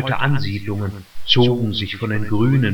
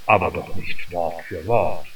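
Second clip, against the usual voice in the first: put-on doubtful old gentleman; 7 semitones lower than usual. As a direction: let the older man sound lightly incredulous. Old councillor doubts the boy.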